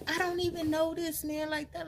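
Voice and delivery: high, stunned disbelief; can't believe what she's hearing; shocked disbelief. Retold or imitated. Telling her aunt, in shocked disbelief. Shocked retelling of pregnancy test.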